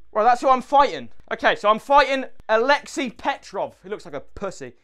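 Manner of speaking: ordinary talk, nothing marked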